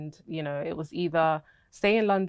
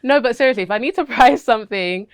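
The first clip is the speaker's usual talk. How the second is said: laughing; 7 semitones higher, 13 dB louder than usual. Real, not acted. Recovering from laughter.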